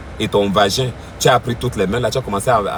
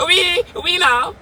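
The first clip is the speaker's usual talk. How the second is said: very high squawked parrot mimicry; mock parrot. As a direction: squeal it like a parrot in the forest. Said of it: "On dirait un perroquet dans la forêt". (imitation moqueuse de perroquet, voix suraiguë)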